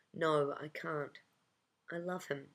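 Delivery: plain reading voice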